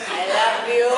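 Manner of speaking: put-on high, loud gushing drunk voice; happy drunk. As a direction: play the sloppy happy drunk who loves everyone. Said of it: "I'm one of them happy drunks".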